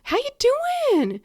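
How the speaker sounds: high, loud, drawn-out friendly call from a car; neighbor yelling hello. Retold or imitated. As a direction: sing it out across the street to a neighbor. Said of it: "yells out".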